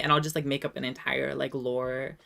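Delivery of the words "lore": ordinary talk, nothing marked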